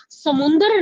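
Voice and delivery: "समुद्र" said with an extra nasal n, as samundar; mispronounced samundar. Shown as a demonstration. Teacher warns students of a spelling slip, "समुद्र" mispronounced with a nasal n. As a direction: slip an n into "समुद्र", like everyday speech. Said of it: "समुद्र नहीं होता। हम बोलने में गलती करते हैं".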